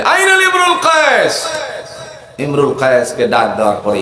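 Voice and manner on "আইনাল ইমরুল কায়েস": high, projected summoning cry; calling out. Acted. Abu Jahl summoning the poet, high calling shout. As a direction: holler for someone far away. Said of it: "ডাক দিল আবুল হেকাম আবু জাহেলে".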